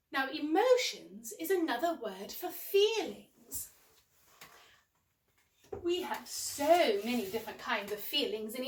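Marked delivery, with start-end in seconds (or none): none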